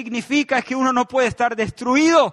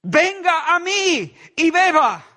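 calling out; 8 semitones higher, 6 dB louder, slower than usual. Acted. Preacher voicing Jesus' call.

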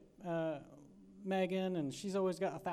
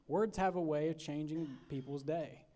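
ordinary talk

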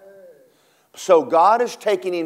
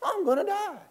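high whimpering wail of a scared man; squeaky comic panic; mock panic; 3 dB quieter than usual. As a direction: play Elijah hamming up terror. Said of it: "He goes".

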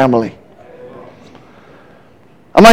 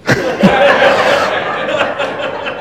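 breathy high laugh; 8 semitones higher, 8 dB quieter than usual. Real, not acted. Laughs after his punchline.

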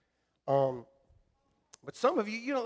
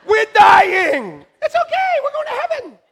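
very high loud laughing chirp; cheery wife voice; 14 dB louder than usual. Retold or imitated. Pastor Alicia happy while dying in a storm, high chirpy. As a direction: sing out doom happily.

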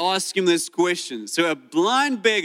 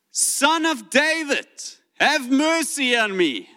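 slow, higher pleading call; mock cry out; 8 semitones higher, slower than usual. Blind beggar calling out to Jesus, mildly pleading. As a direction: cry out for mercy in a drawn-out plea. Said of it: "And he screams out".

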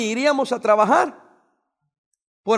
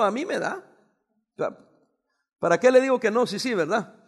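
ordinary talk

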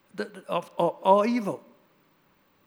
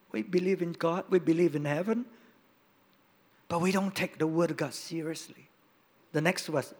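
usual speaking voice

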